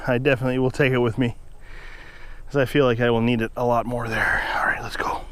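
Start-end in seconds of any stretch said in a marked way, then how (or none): 2.5-5.2 breathy whisper, winded. Real, not acted. Trails into whisper on the trail.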